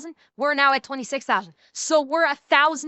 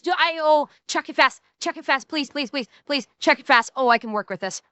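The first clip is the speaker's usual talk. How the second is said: ordinary talk, nothing marked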